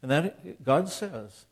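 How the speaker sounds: ordinary talk, nothing marked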